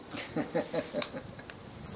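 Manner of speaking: breathy, unvoiced chuckle; a quiet laugh at his own audacity; soft laugh. Real, not acted. Vlogger chuckling after shocking his viewers, soft laugh.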